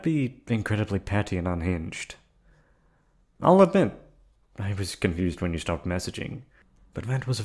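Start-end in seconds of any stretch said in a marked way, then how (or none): 3.4-3.9 a loud, high burst on the admission; sudden emphatic admission. Acted. Kidnapper admitting confusion to captive, loud high burst. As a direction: blurt it out like it costs her something.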